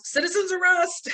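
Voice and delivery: high mock shout with a laugh; retold yell. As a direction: yell it out like a hero, half laughing. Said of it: "Ray yells".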